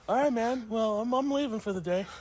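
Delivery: high, loud, teasing goodbye; mock-breezy farewell. Prankster leaves friend on the toilet, mock-breezy farewell. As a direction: call it out innocently to the stranded friend.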